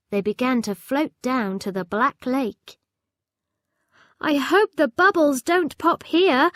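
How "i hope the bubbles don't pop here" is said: a raised, anxious call; yelled. A narrator's reading. Chip nervously calling out over the lake. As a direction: call out the worry. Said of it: "yelled Chip".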